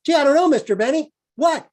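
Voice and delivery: put-on high, loud, fast eager young guy. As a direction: gush it out like a bushy-tailed kid. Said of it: "this whole bit".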